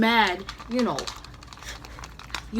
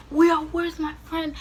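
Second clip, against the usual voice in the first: retold urgent call; 8 semitones higher, 4 dB louder than usual. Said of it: "I'm like".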